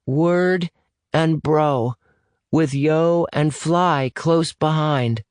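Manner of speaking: put-on high child's voice, slowing at the end; little boy. Boy proves who he is by phone, high child voice. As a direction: answer high and young, spacing it out. Boy answers the test question.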